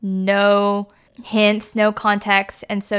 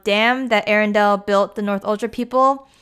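usual speaking voice

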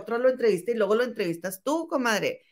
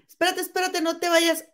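calling out; 6 semitones higher than usual. Retold or imitated. Acting out calling a guest back.

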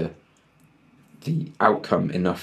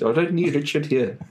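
put-on higher South African accent; 8 semitones higher than usual. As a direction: play the South African guy. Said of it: "South African accent".

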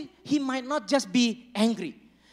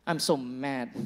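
lower, softer man's admission; low mumbled confession; 7 semitones lower, 7 dB quieter, slower than usual. Shown as a demonstration. Pastor voicing a man telling a friend, low and flat.

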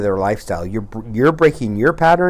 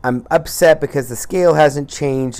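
higher, louder mock whine about the scale; whining; 6 semitones higher, 5 dB louder than usual. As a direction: whine like a frustrated dieter. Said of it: "you do any of your whining".

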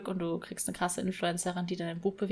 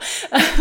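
short laugh; 9 semitones higher, 11 dB louder than usual. Real, not acted. Laughing at the imagined reply.

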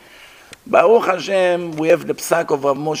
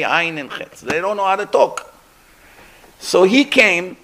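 ordinary talk

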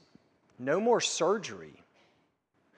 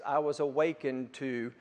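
normal talking voice

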